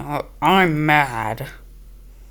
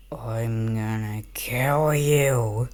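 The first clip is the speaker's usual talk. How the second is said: very slow, low, softer threat; mock menace; 6 semitones lower, 5 dB quieter, much slower than usual. Playing at threatening to kill in game, slow low menace. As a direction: stretch it out as a pretend creepy threat.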